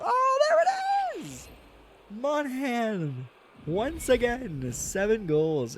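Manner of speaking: high, drawn-out goal celebration; excited goal call. Real, not acted.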